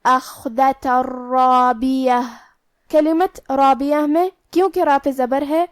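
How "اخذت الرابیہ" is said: slow chanted اخذت الرابیہ, the tanween swallowed into a doubled r; merging without ghunnah. Shown as a demonstration. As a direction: merge the n of اخذت straight into the ر of الرابیہ with no nasal hum. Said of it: "تنوین کو را میں ملا کے بغیر غنہ سے".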